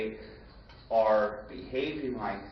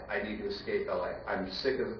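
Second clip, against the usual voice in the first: ordinary delivery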